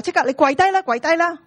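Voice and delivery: shouted command. Acted. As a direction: call it out loudly, echoing the guide's shout. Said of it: "he shouted to him".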